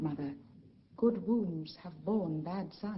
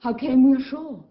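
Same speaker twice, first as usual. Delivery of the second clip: much louder, higher, urgent question; loud, eager interruption; 5 semitones higher, 10 dB louder than usual. Acted. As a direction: burst in, desperate to know. Miranda eager for the story.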